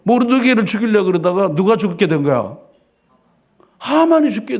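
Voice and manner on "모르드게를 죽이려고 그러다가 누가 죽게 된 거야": ordinary delivery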